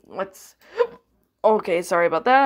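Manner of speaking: hiccuping mid-sentence; hiccups. Real, not acted. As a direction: talk through hiccups. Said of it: "I'm hiccuping".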